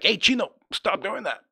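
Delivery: put-on high, loud call to a naughty kid; a father calls out loudly to stop his misbehaving little boy; dad scolding a brat. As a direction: imitate a father snapping at his son to stop. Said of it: "he'd be like".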